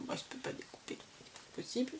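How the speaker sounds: breathy whisper under her breath; chuchoté pour soi-même; whispered aside. Real, not acted. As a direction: whisper it to yourself, almost unheard. Muttered aside to herself.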